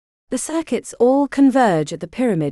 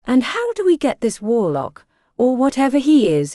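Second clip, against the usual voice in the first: higher-pitched, sarcastic challenge; 6 semitones higher than usual. A narrator's reading. Blake doubting they can position the warlock, sarcastic. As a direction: question the plan with sarcasm, voice raised in pitch. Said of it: "said Blake sarcastically".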